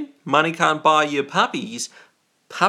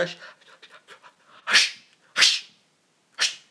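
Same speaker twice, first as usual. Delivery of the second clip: hushing. Pretend. Streamer shushing viewers over Christmas presents, hushing. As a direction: shush them conspiratorially.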